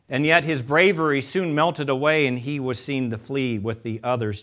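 ordinary talk, nothing marked

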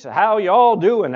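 slow, loud, high Southern drawl; loosely imitated Southern greeting, drawn out. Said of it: "in the thickest Southern drawl I'd ever heard".

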